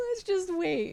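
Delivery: high, far-off worried little voice; mock nervous high voice; timid hesitation. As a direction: squeak it out like someone afraid to touch the new thing. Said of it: "They're like".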